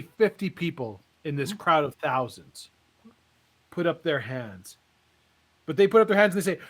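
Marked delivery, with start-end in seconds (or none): none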